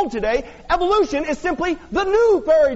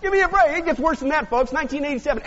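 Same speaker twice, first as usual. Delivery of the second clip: ordinary delivery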